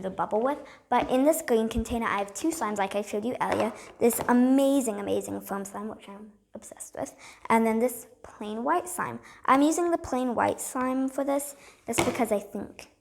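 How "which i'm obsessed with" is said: whispered aside; breathy secret aside. Real, not acted. Aside about favourite slime.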